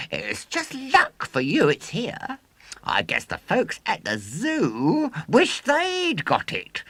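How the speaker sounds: put-on high, loud boastful showman boy. A narrator's reading. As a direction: crow it like a swaggering boy showman. William's showman patter.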